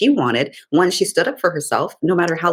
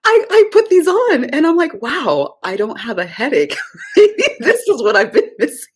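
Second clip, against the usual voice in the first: high, loud, near tears with laughter; gush, laughing and almost crying; amazed she can see without a headache; overwhelmed, laughing; 10 semitones higher, 4 dB louder than usual. Real, not acted. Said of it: "Like, I'm about to cry".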